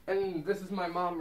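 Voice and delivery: muffled behind cloth mask; speaking after pulling mask up; through a face mask. Real, not acted. Seen 4: Mask under chin until ~3s, then pulled over mouth and kept on.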